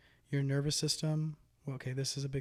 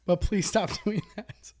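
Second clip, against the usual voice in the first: slow, laughing through words; stifled giggles; 6 semitones higher, 7 dB louder than usual. Real, not acted. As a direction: hold back a giggle while speaking. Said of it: "I'm trying not to laugh".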